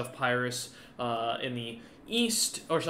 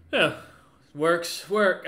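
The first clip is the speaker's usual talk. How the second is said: ordinary talk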